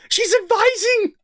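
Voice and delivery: high-pitched disbelief; incredulous outburst. Real, not acted. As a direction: jump up high in amazed ridicule. Disbelief at new title.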